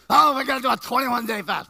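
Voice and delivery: mock eager believer. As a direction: burst out like an over-keen follower. Mocks copycat fasting believers.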